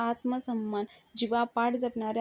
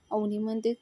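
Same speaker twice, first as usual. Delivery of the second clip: plain reading voice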